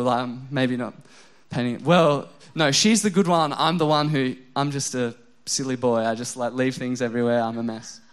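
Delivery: ordinary talk, nothing marked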